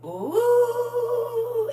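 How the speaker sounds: loud, high drawn-out "ooh"; high whoop. Real, not acted. High whoop in lively chat.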